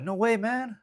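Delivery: high, squeaky-pitched little dog in disbelief; high-voiced cartoon dog reacting; incredulous Chihuahua. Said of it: "The Chihuahua gonna be like".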